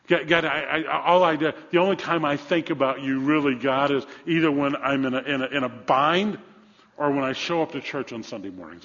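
forceful, stumbling admission to God; preached confession. Acted. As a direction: stammer out a confession from the pulpit.

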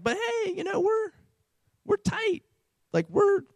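high, soft wheedling voice; cajoling. Acted. Acting out Israel cajoling God.

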